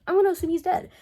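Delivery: loud, high-pitched declaration; emphatic repeat. Real, not acted. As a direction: punch up "I'm gonna assume he's dead" high and loud. Repeats it emphatically for comic effect.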